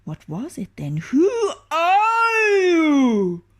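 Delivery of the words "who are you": much higher, louder yelling question; ogre roar. Acted. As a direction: demand it with a startled roar. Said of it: "roared the ogre".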